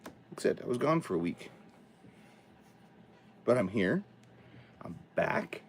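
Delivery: normal talking voice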